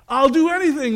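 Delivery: high acted outburst; desperate pleading. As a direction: agree eagerly, desperate to be saved. Jack begs to be rescued.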